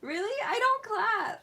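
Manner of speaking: high and loud, cracking with laughter; real laughing protest; laughing denial. Real, not acted. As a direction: protest through giggles.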